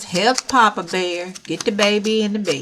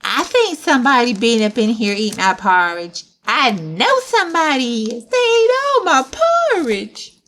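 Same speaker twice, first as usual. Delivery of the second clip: put-on upset child, climbing to a high loud wail; Baby Bear; 4 semitones higher, 3 dB louder than usual. Baby Bear finds his porridge eaten, rising indignant wail. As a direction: build the complaint to a high cry.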